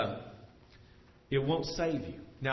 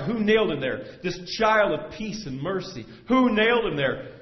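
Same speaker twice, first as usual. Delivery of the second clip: raised, louder, upset demand; anguished cry; 7 semitones higher, 8 dB louder than usual. Acted. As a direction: cry out in outrage at the crucifixion. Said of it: "I cried".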